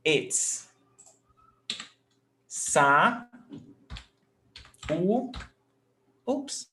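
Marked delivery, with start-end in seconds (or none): 2.5-5.3 "sa", long pause, "u"; syllable by syllable. Shown as a demonstration. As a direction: sound out "sa" and "u" as separate syllables. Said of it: "When we separate saúde".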